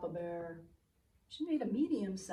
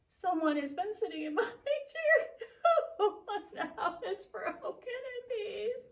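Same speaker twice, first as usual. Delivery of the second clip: put-on much higher, loud wailing baby bear; a little bear laughing-crying over lost porridge; 11 semitones higher, 5 dB louder than usual. Baby Bear wails that his porridge is all gone. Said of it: "And Baby Bear said".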